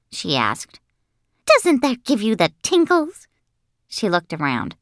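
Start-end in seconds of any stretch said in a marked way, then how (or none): 1.4-3.3 high, squealing schoolgirl question; very high, rising, eager girl; excited show-off girl. Acted. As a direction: gush and fish for agreement.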